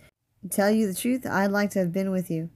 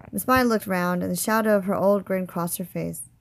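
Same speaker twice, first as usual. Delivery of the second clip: ordinary delivery, nothing marked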